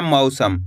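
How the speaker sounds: मौसम said "mau-sam", first vowel gliding like "ow". Shown as a demonstration. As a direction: open मौसम with an a-to-u glide, not a pure o. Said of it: "इस तरीके से पढ़ना है". (मौसम में पहला स्वर "औ", यानी अ से उ की ओर बोलें)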